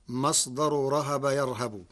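normal talking voice

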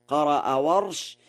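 usual speaking voice

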